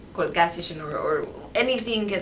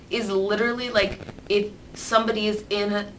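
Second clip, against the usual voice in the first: ordinary delivery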